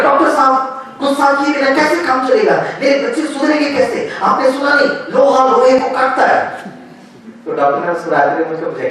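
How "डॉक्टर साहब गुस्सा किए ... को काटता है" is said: irritated retort. Retold or imitated. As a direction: snap back at the doctor, worked up. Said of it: "मुझे और गुस्सा आया".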